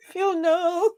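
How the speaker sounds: real helpless laughter, high-pitched; laughing. Real, not acted. Woman laughing at Phil over the cable numbers, laughing hard. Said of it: "You know why I'm laughing".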